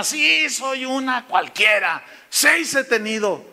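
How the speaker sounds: confesión aguda y alargada; samaritana confesando. Acted. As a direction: confiesa lento, dramatizando a la samaritana. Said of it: "como la samaritana". (confesión dramatizada, lenta)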